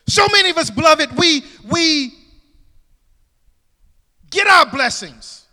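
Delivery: loud, high preaching cry; full-force preaching. Acted. Pastor exhorting his church, full-force preaching. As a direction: preach it out to the congregation.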